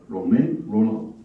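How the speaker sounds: a light French accent on the name. Shown as a demonstration. Host introducing a reader's author, light French accent. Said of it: "I'm just doing my best French accent".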